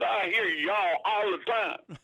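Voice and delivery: high, slow mock New York Yankee; New York accent. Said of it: "he had that New York accent".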